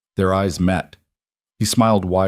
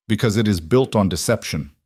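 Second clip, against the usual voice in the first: ordinary delivery, nothing marked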